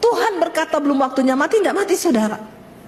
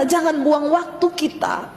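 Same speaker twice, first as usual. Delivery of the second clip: ordinary talk, nothing marked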